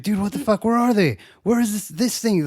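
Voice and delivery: high, exasperated imitation of a customer; mock frantic. Customer hunting the shelves asks the clerk, mock frantic.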